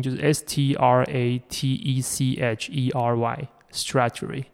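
slow letter-by-letter spelling, S T R A T E C H E R Y. Shown as a demonstration. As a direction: spell out S T R A T E C H E R Y slowly. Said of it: "那怎么拼？".

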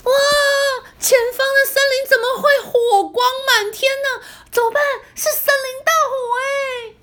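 high, fast panicked voice; frightened cry about the fire; worried and tense. Acted. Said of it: "小红非常担心的、紧张的说".

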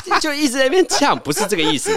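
high, laughing burst. Real, not acted. Laughing about the feisty listener.

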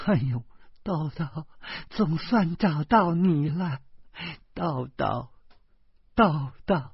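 put-on high, sobbing voice on finding a lost child; tearful cry of relief. A narrator's reading. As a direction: weep the name with relief. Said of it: "他失声哭出声来了". (失声哭着呼唤)

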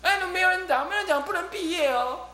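high, needling mock threat; provoking taunt. Huikong teasing the group that nobody graduates, high mock threat. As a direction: goad them with a playful warning. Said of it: "慧空法师就在挑衅说".

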